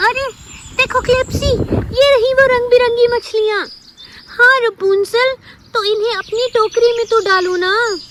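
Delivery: high, quick, delighted voice; excited friend. Acted. Klepsi urges Rapunzel to grab the fish, high and eager. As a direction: squeal with joy at the find.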